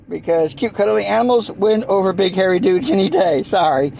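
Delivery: ordinary talk, nothing marked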